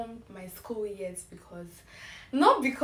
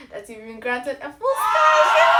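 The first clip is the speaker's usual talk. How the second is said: loud, rising thrill on the good news; joyful announcement; 5 semitones higher, 13 dB louder than usual. Retold or imitated. Student reads her acceptance email, bursting out loud. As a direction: build it up and burst out at the end.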